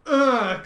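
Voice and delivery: a loud strained ugh of dread; bracing groan before the click; tense groan. Retold or imitated. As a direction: groan as you force yourself to click. Nervously clicking the OK button.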